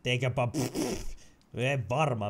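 ordinary delivery

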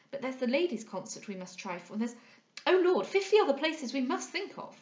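lively, fussing hostess with swooping pitch; flustered society hostess. Acted. Hostess apologising to her guest, flustered and lively. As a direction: rattle it off like an apologetic hostess remembering things. Missus Harrel's figure voiced in narration.

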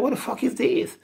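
squeaky high disbelief; retelling his reaction, high mock voice; high incredulous mock. His own retort quoted.

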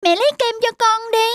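put-on high child voice, demanding; a small boy pestering his mother for ice cream; whiny little boy. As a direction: son refusing dinner and begging for ice cream. Child refusing food.

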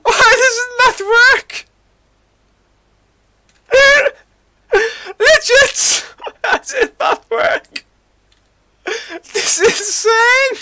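high, loud cries broken by laughter; laugh-shout in helpless disbelief; laughing exasperation. Real, not acted. Laughing despair at broken stream.

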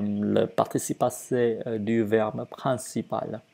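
normal talking voice

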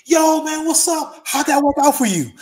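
put-on squeaky boxer ribbing an old wrestler; high Tyson voice. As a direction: Tyson greeting him with a high, loud tease. Voicing Mike Tyson teasing.

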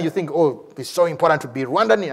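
ordinary delivery

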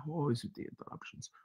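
fast muttered complaint; muttered aside. Acted. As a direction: mutter it under your breath, quickly. Repeats complaint, quieter.

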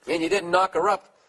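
ordinary delivery

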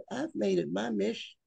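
ordinary delivery

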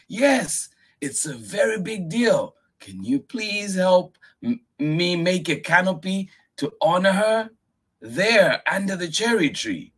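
put-on high child voice asking nicely; polite request. Miyuki politely asking grandfather for help. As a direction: ask sweetly for help. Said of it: "Miyuki asked politely".

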